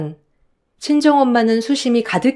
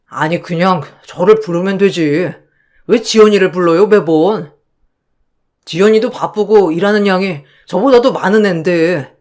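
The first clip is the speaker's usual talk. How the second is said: husband's voice; 2 semitones lower than usual. Acted. Husband confronts his mother.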